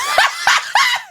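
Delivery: uncontrolled squeals of laughter; helpless laughter. Real, not acted. Bursting out laughing at a sight.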